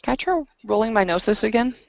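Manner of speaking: normal talking voice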